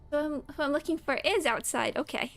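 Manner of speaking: normal talking voice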